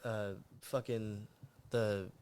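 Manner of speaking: slow, foggy hungover speech; groggy and sluggish, words slow. Real, not acted. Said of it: "we're a little hungover".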